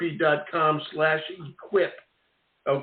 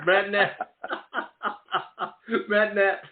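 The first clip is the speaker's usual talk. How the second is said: laughing; 5 semitones higher, slower than usual. Real, not acted.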